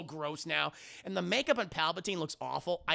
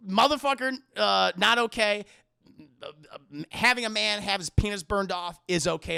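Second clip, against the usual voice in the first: normal talking voice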